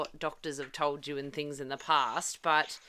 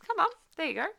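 much higher singsong coaxing; coaxing the baby; 11 semitones higher, much faster than usual. Retold or imitated. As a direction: gently urge a fussy baby along. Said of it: "but I'm like".

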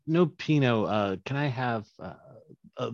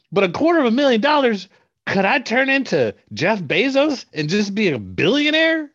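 excited; 13 semitones higher, 12 dB louder than usual. Acted. Excited caller pushing back.

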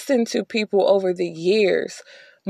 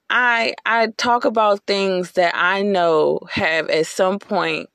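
ordinary delivery

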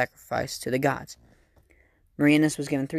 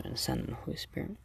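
whispering; 12 dB quieter, faster than usual. Real, not acted. Quick whispered sign of cross.

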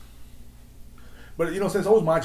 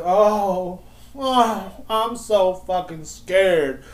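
higher, drawn-out sarcastic fear; mock scared; 5 semitones higher, much slower than usual. Podcaster mocking a man who tried to scare him, sarcastic mock fear. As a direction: stretch it out in feigned terror.

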